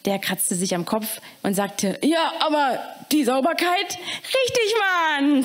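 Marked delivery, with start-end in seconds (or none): 2.0-4.0 higher, put-on caretaker query; caretaker's question. Caretaker Eddie testing the rule, higher put-on voice. 4.0-5.4 high, delighted shout of Richtig; joyful cheer. Acted. The students praising the caretaker, high and delighted. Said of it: "freuten sich alle".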